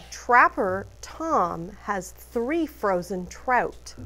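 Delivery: plain reading voice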